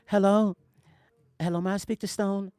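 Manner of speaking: a hint of a gentle, polite caller. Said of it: "This is exactly how he sounded".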